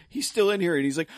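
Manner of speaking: normal talking voice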